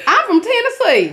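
loud, high mock Tennessee twang; country accent. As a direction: tease with a hammed-up Southern accent. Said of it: "I have a country accent".

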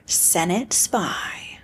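hushed slow mock-secret whisper; slow conspiratorial whisper. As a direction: breathe it like a spy's secret. Dramatic whispered episode title.

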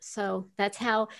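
normal talking voice

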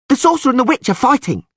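high, fast urchin's cry; excited yell. A narrator's reading. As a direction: blurt out the news at top pitch. Urchins spreading the news.

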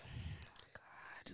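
breathy stunned whisper; whispered oh my God. Retold or imitated. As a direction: whisper it under your breath. Retold reaction to a threat.

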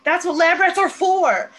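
hammed-up yell back; mock yelling. Price yelling back at Olivia, mock loud. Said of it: "And he's like".

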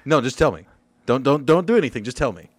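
loud, insistent demand; exasperated. Real, not acted. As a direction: push him to just say it.

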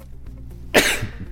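sudden sneeze on air; sneezing. Real, not acted. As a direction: let out a sneeze. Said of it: "yayında hapşuraya yakalanmak".

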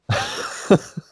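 airy little laugh; breathy laugh. Real, not acted. Laughing after the story.

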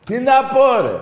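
high, loud fed-up yell; exasperated shout. Real, not acted. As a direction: throw up your hands loudly. Exasperated outburst.

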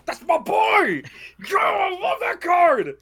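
high excited yelling. Real, not acted. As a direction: shout it out, thrilled. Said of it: "I think [name]'s excited".

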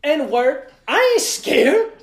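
high, loud, slow cocky voice; mocking a tipsy friend who claims he isn't scared; mock bravado. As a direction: puff up like a tipsy tough guy.

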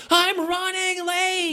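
hammed-up flustered wife; imitating wife. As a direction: wail it at the last second. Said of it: "will be like".

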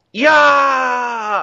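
high, loud charging yell; mock battle cry. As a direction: scream it like a crazed woman charging in. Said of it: "All you hear is".

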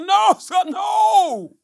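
high, loud laughing no sir; real laughing no; laughing refusal. Real, not acted.

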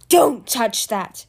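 loud, slow, forceful roar. A narrator's reading. Captive stops him reaching for necklace, roared. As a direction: roar each word out. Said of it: "roared Pyrite".

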